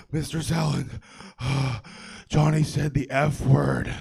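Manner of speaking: out of breath from sprinting, gasping out the tattle; heave, then tell on Johnny; panting tattletale kid. Retold or imitated. Out-of-breath kid tattling to teacher, panting between words.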